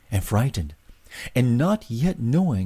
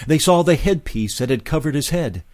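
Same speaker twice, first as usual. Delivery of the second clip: ordinary delivery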